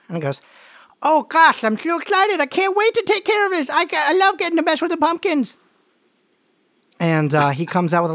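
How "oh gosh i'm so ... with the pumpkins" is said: put-on very high, eager kid voice; squeaky, rushed kid character; excited little brother. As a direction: gush like a thrilled little brother. Game master voices little brother.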